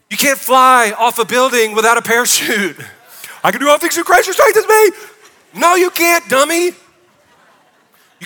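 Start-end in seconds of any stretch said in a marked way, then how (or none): 3.4-4.9 much higher, louder goofy voice with a laugh; mock a clueless believer misquoting the verse; laughing parody of a dummy quoting scripture; mocking fool. Shown as a demonstration. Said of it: "we love to misquote that scripture". 5.6-6.7 high, loud mock-scolding with a laugh; scolding retort. Answering the mocked misquote.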